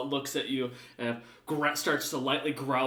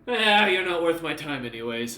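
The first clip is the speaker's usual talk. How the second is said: louder, contemptuous brush-off; dismissive sneer; 3 semitones higher, 7 dB louder than usual. Acted. Alfred dismissing the dog before walking off, louder scorn. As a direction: wave them off as beneath you. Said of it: "looks at you and kinda".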